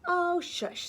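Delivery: high, loud shush; dismissive pig. Acted. Second pig hushing the stick man, high and loud. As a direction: wave him off with a high snappy shush.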